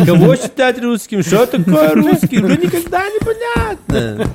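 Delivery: put-on high, lilting Odessa accent; odessa russian accent. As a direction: Odessa aunt, laughing through it. Joking about who counts as Russian. (одесский акцент, высоко и нараспев)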